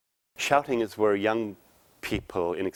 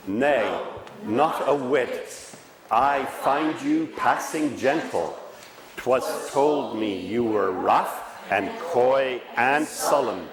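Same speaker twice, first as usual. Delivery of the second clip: slow, higher and louder, every word enunciated; slow clear enunciation; 4 semitones higher, 5 dB louder, much slower than usual. Shown as a demonstration. Coach demonstrating the Shakespeare line slowly and clearly enunciated. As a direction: savour each word like verse. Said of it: "Difference. Anybody hear differences?".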